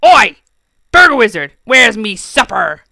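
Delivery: loud, rough demanding shout. Acted. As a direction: bark it for supper. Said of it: "he demanded".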